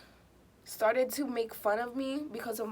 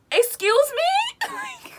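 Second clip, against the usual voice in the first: high, slow, stretched disbelief; shocked outrage; 7 dB louder, much slower than usual. Retold or imitated. Hearing the girl say Ted is her boyfriend. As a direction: drag it out in mock shock.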